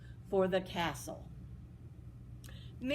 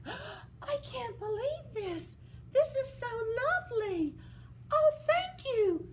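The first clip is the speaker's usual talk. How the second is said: put-on high, awed girlish voice; breathless wonder at the gown; amazed Cinderella.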